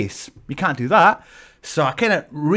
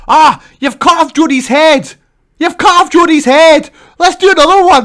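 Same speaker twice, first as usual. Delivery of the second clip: high loud fussy woman's voice; excited fan's wife; 12 semitones higher, 12 dB louder than usual. Retold or imitated. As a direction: shriek it at him like an overexcited fan. Fan's wife demanding another photo.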